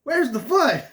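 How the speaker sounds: loud, high squeal of the line; high mock yell again. Reviewer unable to stop quoting, loud and high. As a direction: squeal it up high, barely holding in laughter. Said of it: "cracks up again".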